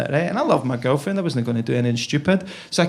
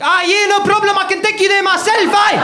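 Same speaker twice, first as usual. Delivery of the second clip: a scary over-the-top South African helper; South African accent; 13 semitones higher than usual. Pretend. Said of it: "by pretending to be South African".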